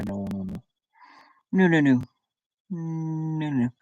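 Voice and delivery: usual speaking voice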